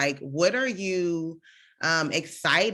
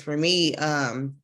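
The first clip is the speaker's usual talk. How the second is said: normal talking voice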